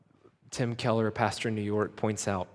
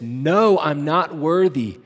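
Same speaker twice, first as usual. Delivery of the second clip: higher and louder, crying out his unworthiness; loud anguished admission; 6 semitones higher, 12 dB louder, slower than usual. Acted. Jacob crying out about his own worth, loud anguished admission. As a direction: blurt out the confession.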